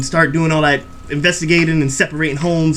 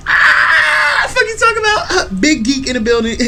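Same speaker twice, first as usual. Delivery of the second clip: hype shout; 10 semitones higher than usual. Real, not acted.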